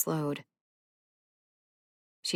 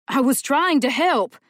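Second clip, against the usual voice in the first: defensive shout; 8 semitones higher, 8 dB louder than usual. Acted. Dell defends himself in fight.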